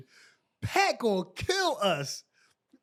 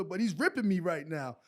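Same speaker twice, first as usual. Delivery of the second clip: ordinary talk, nothing marked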